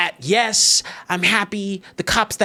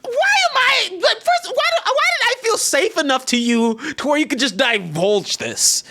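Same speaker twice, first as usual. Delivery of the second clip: high-pitched, spluttering outrage; exasperated disbelief; 11 semitones higher than usual. Retold or imitated. Exasperated retelling of own disbelief.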